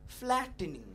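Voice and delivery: ordinary talk, nothing marked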